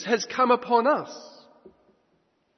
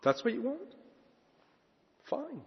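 soft reply; 5 semitones higher, 11 dB quieter than usual. Real, not acted. Preacher answers imagined worshipper softly.

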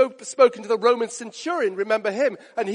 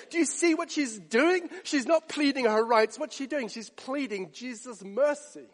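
usual speaking voice

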